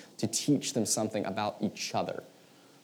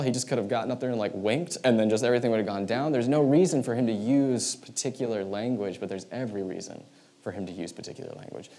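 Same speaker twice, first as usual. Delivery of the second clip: ordinary talk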